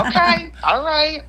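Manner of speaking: high, laughing concession; amused acceptance. Retold or imitated. Teller reacting to friend's bedroom confession, laughing and amused. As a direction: give in through a laugh.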